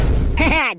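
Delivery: short teasing laugh. Acted. Son teasing his friend about envying his father, laughing.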